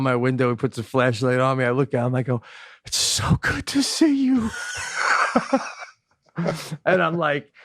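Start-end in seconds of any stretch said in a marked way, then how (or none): 2.8-5.6 relieved high squeak. Retold or imitated. Relieved greeting to trooper.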